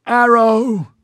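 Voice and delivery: high, loud alarm shout; forceful shout. Acted. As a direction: yell the warning with all force. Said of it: "broadcast back at them as forcefully".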